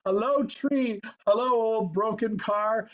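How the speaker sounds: high, loud, beaming greeting, slowing down; overjoyed greeting. Retold or imitated. George Bailey greets tree and car, overjoyed. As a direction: greet the world like a man reborn. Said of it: "he says".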